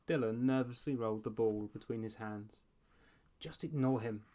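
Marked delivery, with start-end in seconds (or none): none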